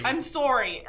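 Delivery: Canadian "sorry" with a rounded O; put-on Canadian apology; Canadian accent. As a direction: apologize like a Canadian, "SOH-ree". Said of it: "I'm Canadian now".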